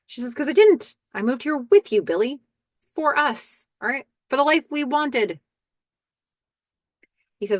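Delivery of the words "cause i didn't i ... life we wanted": higher and louder, mildly heated insistence; a little pissed. Retold or imitated. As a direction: push back at him, a touch of anger. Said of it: "She's a little pissed".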